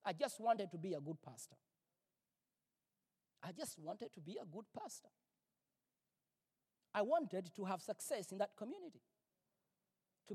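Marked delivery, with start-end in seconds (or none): 3.4-5.1 much softer, rueful repeat; soft echo. Real, not acted. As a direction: let the line fall away, ashamed.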